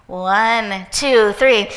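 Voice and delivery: loud build-up count, rising on three. Acted. Counting the audience into a breath.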